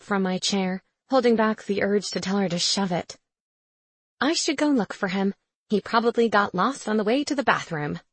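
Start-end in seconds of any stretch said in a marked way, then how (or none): none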